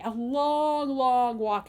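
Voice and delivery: ordinary delivery, nothing marked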